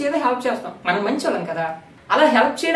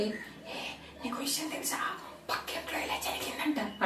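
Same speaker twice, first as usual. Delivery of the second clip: hushed secretive whisper; gossip whisper; 3 semitones higher, 17 dB quieter than usual. Retold or imitated. Mother and daughter sharing neighbour gossip, in a whisper. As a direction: whisper the news as if it's a big secret. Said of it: "మెల్లిగా మాట్లాడతారనమాట".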